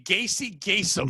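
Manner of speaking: slow, drawn-out mock announcement; radio-name call-out. Dubbing the guest a radio name, slow mock call. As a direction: stretch GACY GAYSOM like a radio name. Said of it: "That's your new radio name".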